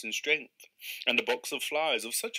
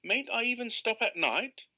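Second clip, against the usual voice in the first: much higher pleading question; rising panic; dismayed; 10 semitones higher than usual. Acted. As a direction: angler pleading with the keeper. Angler's growing dismay.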